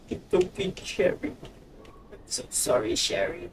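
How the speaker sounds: soothing hushed apology to a friend; gentle whispered reassurance; tender whisper. Real, not acted. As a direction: whisper it softly, comforting her. Whispering comfort to game character.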